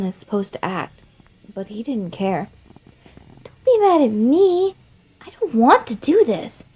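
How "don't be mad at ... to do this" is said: put-on high, loud childlike plea; a child asking not to be blamed, high and loud; high kid voice, half pleading; Flowey, pleading child. Flowey pleads while strangling.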